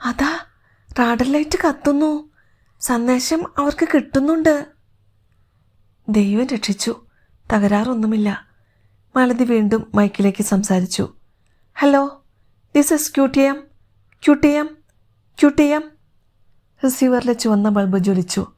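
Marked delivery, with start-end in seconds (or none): 0.0-4.7 high-pitched, thrilled exclamation; amazed excitement. Acted. Older woman sees the radar light come on, amazed and excited. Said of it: "അത്ഭുതപ്പെട്ട് Karthyayani ആവേശത്തോടെ പറഞ്ഞു". 11.7-15.9 higher, measured calling into a mic; radio call-sign. Acted. Woman speaks into the mic to the rocket, radio calling.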